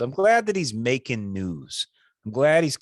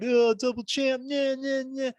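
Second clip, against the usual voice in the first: much higher, showy flexing imitation; mock gloating champ; 13 semitones higher than usual. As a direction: ham up a smug victory boast. Mocking Cejudo flexing.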